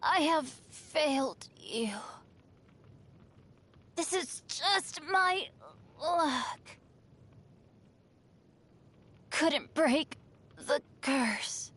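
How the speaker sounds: slow, halting, fading delivery; defeated last words. Acted. Fallen adventurer admits failing the team, weakly defeated. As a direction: let the words drag out with broken pauses.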